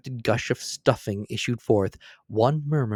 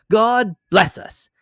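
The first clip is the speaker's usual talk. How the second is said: higher, louder, slow blessing from the father; father blesses the family, slow and lifted; 11 semitones higher, 8 dB louder, slower than usual. A narrator's reading. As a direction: draw out the blessing high and full. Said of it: "Then Bob proposed".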